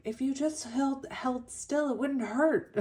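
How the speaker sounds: high scolding heroine voice; Beauty's voice. Retold or imitated. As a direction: chide him gently, voice up high. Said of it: "she's like".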